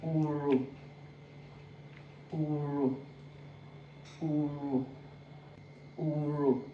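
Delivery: uru with the R sounded, held level; pronounced R in uru. Shown as a demonstration. Teacher drilling the sounded R in uru. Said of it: "is the one that is pronounced".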